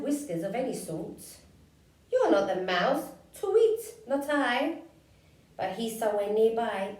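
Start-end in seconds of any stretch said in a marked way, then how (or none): 2.1-4.8 owl voice. Acted. Owl answers.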